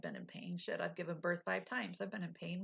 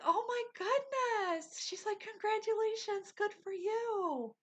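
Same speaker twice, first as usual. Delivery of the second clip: much higher, slow and sing-song, gushing; gushing nurse; 14 semitones higher than usual. Retold or imitated. Nurse congratulating the patient who can see her toes, high and gushing. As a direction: play the thrilled nurse, drawn out and high.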